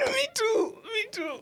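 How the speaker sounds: very high squeaky me too. Pretend. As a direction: squeal it in a silly falsetto. Squeaky agreement.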